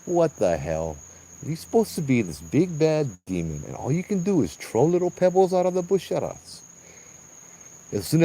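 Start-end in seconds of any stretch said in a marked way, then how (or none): 0.1-6.6 cocky taunt. Retold or imitated. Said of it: "And I'm like".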